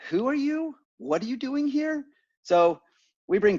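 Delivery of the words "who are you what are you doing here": much higher, puzzled who-are-you voice; high mock bewildered receptionist; mock puzzled staff. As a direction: squeak out the confused question of a stranger at the door. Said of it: "now they're like".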